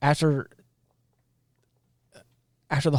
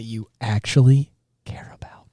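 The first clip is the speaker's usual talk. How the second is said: hushed aside; whisper; 4 semitones lower than usual. Real, not acted. Telling her co-host what everyone knows, in a whisper. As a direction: whisper it like a secret. Confiding the obvious point.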